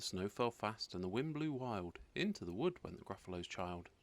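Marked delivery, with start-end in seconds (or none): none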